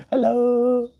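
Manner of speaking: very high birdlike hello; parrot hello. Retold or imitated. Imitating bird's hello.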